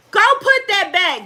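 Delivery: high, loud scolding call; calling out an order. Real, not acted. Mother breaks off to order a child, sharp loud call.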